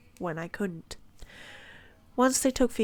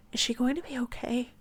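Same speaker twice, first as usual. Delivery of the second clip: higher, anxious question with a mild shake; shaking voice; 5 semitones higher than usual. A narrator's reading. Narrator worried for the injured bird, mildly shaking voice. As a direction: ask it as if worried for her. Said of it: "My voice is shaking".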